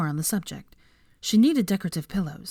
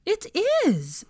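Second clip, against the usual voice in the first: exclaiming; 9 semitones higher, 3 dB louder, much slower than usual. A narrator's reading. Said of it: "exclaimed Hermione".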